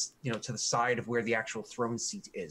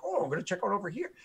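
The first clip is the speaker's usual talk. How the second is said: casual higher voice, tossed off fast; breezy high quick aside; 8 semitones higher, much faster than usual. Pretend. Wandering adventurer casually drifting off to look around. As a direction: sound carelessly curious, rushing. Player character wandering off, quoted.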